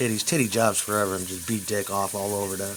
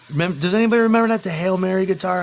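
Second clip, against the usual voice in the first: much higher, louder and fast; excited blurt; 8 semitones higher, 4 dB louder, faster than usual. Real, not acted. Asking viewers about the guitar, high, loud, fast. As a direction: burst out eagerly asking the chat.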